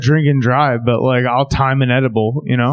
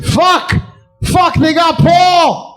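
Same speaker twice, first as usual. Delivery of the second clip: panicked yell; 12 semitones higher, much slower than usual. Pretend. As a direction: scream it as a fleeing gazelle. Gazelles panic as Paul eaten.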